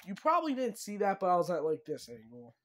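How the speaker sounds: ordinary talk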